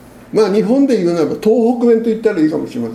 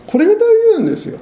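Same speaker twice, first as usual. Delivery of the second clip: high, fast fervent burst; a preacher's sudden high, rapid cry; fervent emphasis; 3 dB louder, much faster than usual. Real, not acted. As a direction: drive the point home, high and quick. Preacher stresses the key point.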